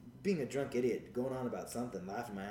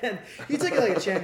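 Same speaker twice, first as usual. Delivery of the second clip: laughing; 8 semitones higher, 8 dB louder than usual. Real, not acted. Laughing while telling.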